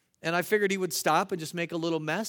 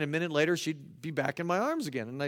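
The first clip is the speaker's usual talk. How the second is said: usual speaking voice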